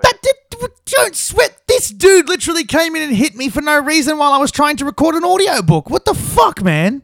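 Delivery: very high, loud angry complaint; outraged ranting. Acted. Narrator complaining about being hit mid-recording, outraged. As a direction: rant at high pitch in disbelief.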